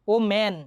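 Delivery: "ওম্যান" with a big, wide "a" in the last syllable; open-vowel woman. Shown as a demonstration. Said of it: "আটা একটু বড় হাতে".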